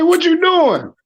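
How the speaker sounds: high, incredulous "what you doing"; mock surprised call. Asking the coworker why he's in back, incredulous. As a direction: call out in disbelief. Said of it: "I say".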